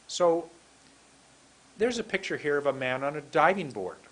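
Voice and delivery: ordinary talk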